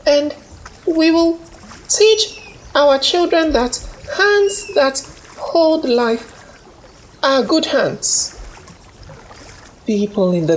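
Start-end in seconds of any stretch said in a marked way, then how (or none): 0.1-8.4 a woman's earnest, raised voice blessing a new name; village woman. A narrator's reading. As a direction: make a promise of respect. Woman honors Amina.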